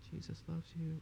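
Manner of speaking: barely audible mumble under the breath; under your breath. Retold or imitated. Timid believer mumbling to a stranger, under the breath. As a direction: say it so nobody hears. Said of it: "Under your breath".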